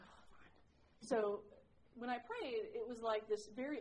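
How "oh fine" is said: barely voiced "Oh, fine", much softer; reluctantly agreeing to pray; grudging mutter. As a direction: mutter it like a sulky teen giving in. Grudging reply to prayer advice.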